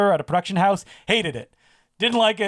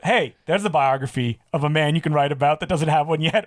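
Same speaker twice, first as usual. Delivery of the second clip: normal talking voice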